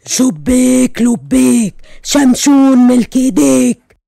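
slow, low booming genie. Acted. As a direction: intone like a grand genie. Genie greets his masters.

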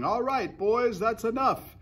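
high-pitched foreman's shout. A narrator's reading. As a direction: call out orders to the work gang. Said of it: "they heard him shouting".

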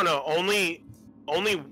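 usual speaking voice

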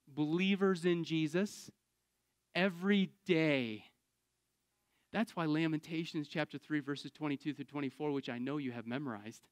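ordinary talk, nothing marked

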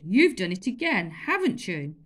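loud, accusing master; angry scolding. Acted. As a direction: tell the boy off loudly.

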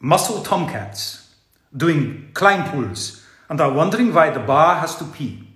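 hammed-up German accent, reading aloud. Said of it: "I'm gonna do the accent".

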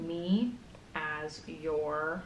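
usual speaking voice

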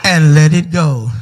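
low, steady voice of God; God tells Keith to let go, low and calm. Acted. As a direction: gently command him to let go.

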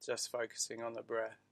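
calm, unhurried meditation voice; guided meditation. Acted. Teacher guiding listeners through breathing, meditation voice. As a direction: ease them into the breath.

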